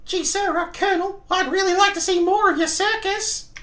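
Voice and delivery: put-on very high, squeaky eager-kid voice; put-on falsetto, addressing a superior; timid circus hopeful. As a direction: pipe up meekly in a cartoon falsetto. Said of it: "said to Colonel Bob".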